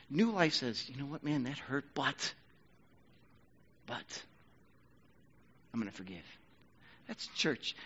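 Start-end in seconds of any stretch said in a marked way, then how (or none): 0.9-6.2 gentle forgiveness. Pretend. New life forgiving gently.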